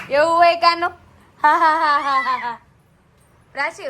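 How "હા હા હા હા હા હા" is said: loud playful ha-ha laugh. Real, not acted. Mother laughing aloud to make baby giggle.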